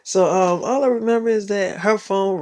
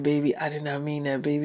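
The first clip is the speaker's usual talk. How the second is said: softer, hushed and sorry; tender apology; 3 semitones lower, 9 dB quieter than usual. Retold or imitated. Boyfriend softly apologises to girlfriend for hurting her eye. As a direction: soothe her gently while apologising.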